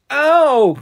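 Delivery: high, loud yelp of pain; pained scream. A narrator's reading. Little brother's fingers bent back, screaming in pain. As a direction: cry out like a hurt little boy. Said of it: "Kenny screamed".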